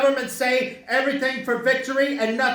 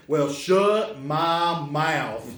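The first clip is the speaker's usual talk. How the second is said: slow, low Southern drawl; Southern accent; 7 semitones lower, much slower than usual. Retold or imitated. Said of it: "they all talk with Southern accents".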